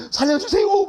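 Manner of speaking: high, loud, desperate scream for help; hammed-up cry of drowning man. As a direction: scream it like you're swept away. Retelling crying for help in river.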